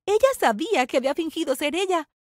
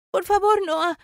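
higher, raised, urgent plea; pleading; 5 semitones higher, 4 dB louder, slower than usual. Acted. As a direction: beg someone not to leave. Le suplica que no la deje. (súplica urgente, en tono agudo)

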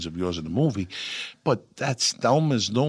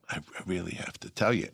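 lowered voice; 2 semitones lower, 7 dB quieter than usual. Retold or imitated. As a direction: drop the volume, almost confiding. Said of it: "I'm lowering my volume".

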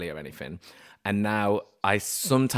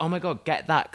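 high, eager burst; excited; 8 semitones higher than usual. Retold or imitated. Diner spotting a fancy rosé, high excitement. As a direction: point at the bottle like you have found the answer. Spotting friend's rich wine.

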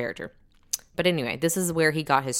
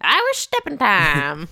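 Irish Step in Time in a Texas accent, a little higher and louder; Texan twang on Irish Step in Time; 4 semitones higher, 5 dB louder than usual. Shown as a demonstration. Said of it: "As I say it in my Texas accent".

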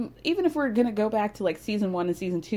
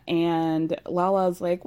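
ordinary talk

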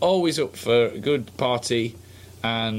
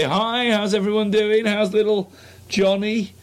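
high, slow, overly cheery hello; chirpy dad greeting; 9 semitones higher, 4 dB louder than usual. Retold or imitated. Dad cheerily greeting the school mums, high and sing-song.

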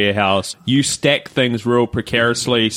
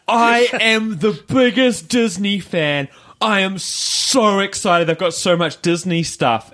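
mock gushing, higher and slow; gushing fan; 7 semitones higher, slower than usual. Retelling the Disney woman introducing herself, mock gushing. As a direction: lightly imitate an overexcited superfan. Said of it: "she looks at me and she goes".

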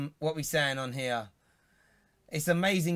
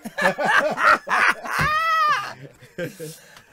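long, high, loud shrieking laugh; real uncontrolled howl of laughter; helpless cackle; 9 dB louder than usual. Real, not acted. Host cracking up at a vulgar comment, helpless cackle.

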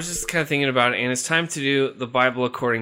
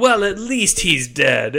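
smug villain; 4 semitones higher, 4 dB louder, slower than usual. Acted. Satan consoles himself.

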